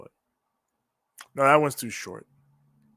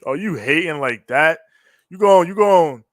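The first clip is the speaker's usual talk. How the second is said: mock outrage; 6 semitones higher, 12 dB louder than usual. Mock outrage at a chat viewer.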